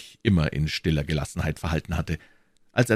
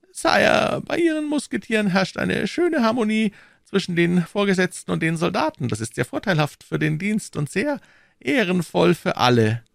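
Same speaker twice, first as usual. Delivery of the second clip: much higher, smooth cardinal voice; silky churchman stepping back, high voice; cardinal's voice; 13 semitones higher than usual. A narrator's reading. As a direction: play the sly cardinal, pitched well up.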